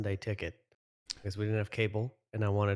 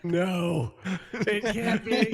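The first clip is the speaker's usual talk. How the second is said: high, slow, laughing disbelief; mock disbelief; 9 semitones higher, 4 dB louder, much slower than usual. Friend reacts to the dead returning, mock disbelief. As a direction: draw it out in hammed-up shock.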